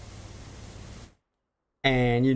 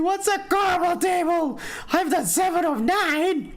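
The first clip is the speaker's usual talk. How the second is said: mock high shout. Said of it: "And so, like".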